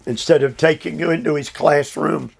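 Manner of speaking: ordinary talk, nothing marked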